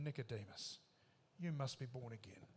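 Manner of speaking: low, quiet and intimate; hushed. Retold or imitated. Jesus quietly tells Nicodemus he must be reborn.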